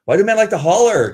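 loud, high hollered question; mock holler. Woman hollering a listener's comment to the room, mock holler.

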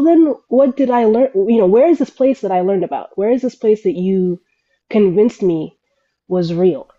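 usual speaking voice

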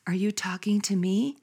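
unvoiced whisper, pointedly gentle; wife whispering back to the megaphone lady; softest voice. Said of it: "in the softest voice possible".

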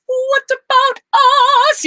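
strained high pop cry. Retold or imitated. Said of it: "he's not going".

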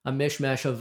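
ordinary talk

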